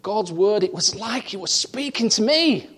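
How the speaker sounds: fervent pulpit delivery, full of conviction; preaching. Acted. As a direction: preach it out to the congregation. Preacher building to climax.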